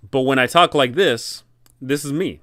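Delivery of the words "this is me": normal talking voice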